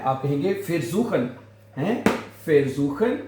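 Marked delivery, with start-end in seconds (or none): none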